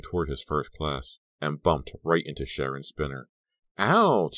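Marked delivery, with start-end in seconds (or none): 3.8-4.4 high, loud, overdone yelp; pained yell. A narrator's reading. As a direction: overreact to a bump, yelling out. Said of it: "she yelled".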